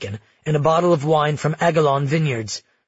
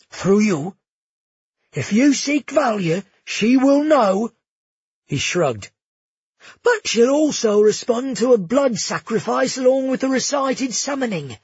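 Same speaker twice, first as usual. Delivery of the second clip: gushing innkeeper; 8 semitones higher than usual. Acted. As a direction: fawn over the princes in a high, thrilled voice. Bruno explaining summoning.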